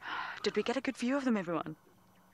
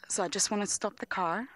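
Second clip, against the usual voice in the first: normal talking voice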